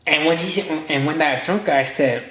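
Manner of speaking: ordinary delivery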